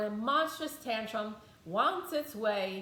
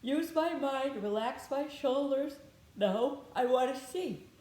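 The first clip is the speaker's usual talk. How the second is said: slow and deliberate; 4 semitones higher, slower than usual. Shown as a demonstration. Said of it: "I intentionally".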